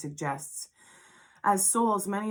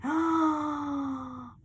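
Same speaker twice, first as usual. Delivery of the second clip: gasp; 8 semitones higher than usual. Real, not acted. Reacts to drawn card.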